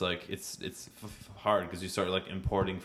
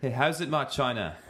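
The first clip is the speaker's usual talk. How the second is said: raised-pitch South African greeting; South African accent; 4 semitones higher than usual. Acted. Trying the friend's phrase, higher South African accent. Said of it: "give me something South African to say".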